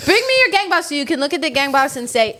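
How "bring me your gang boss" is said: imperious mock demand, higher at the start. Neighbor to the production assistant, mock demand.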